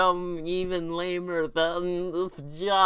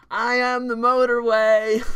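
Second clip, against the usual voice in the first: loud booming declaration; 7 semitones higher, 9 dB louder than usual. Acted. Closing silly line.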